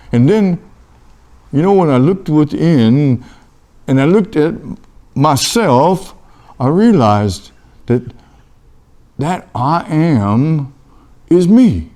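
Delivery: ordinary delivery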